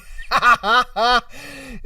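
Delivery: high burst of laughter; laughing. Real, not acted. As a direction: crack up for real. Cracks up at the short sheets.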